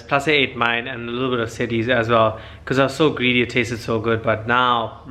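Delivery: ordinary talk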